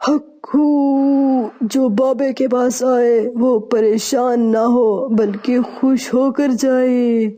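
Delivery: very slow, wailing mystic call, then a man-like preaching tone; mannish voice. Acted. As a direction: chant like a male holy man. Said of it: "پھر سے مردانہ آواز میں شروع ہو گئی تو".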